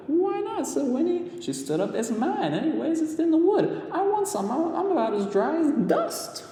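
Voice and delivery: put-on little girl claiming the water as hers; girl character voice. A narrator's reading. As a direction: demand it like a stubborn girl. Girl insisting.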